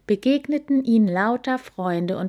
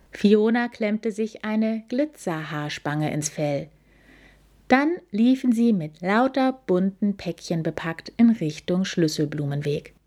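plain reading voice

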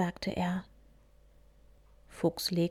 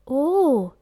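higher, louder gasp of wonder; amazed; 7 semitones higher, 8 dB louder than usual. A narrator's reading. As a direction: marvel at the butterfly. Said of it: "staunte Hase".

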